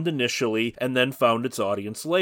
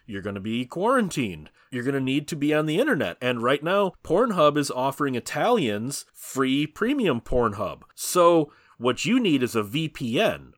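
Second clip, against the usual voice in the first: usual speaking voice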